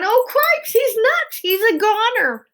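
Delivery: frantic high-pitched mouse shouts; alarmed shouting. A narrator's reading. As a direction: cry out very high and loud. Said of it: "shouted Furlough again".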